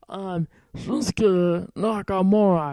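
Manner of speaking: self-admitted bad Japanese accent impression. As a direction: announce the name like Nakamura in a Japanese accent. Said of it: "I can't do a Japanese accent".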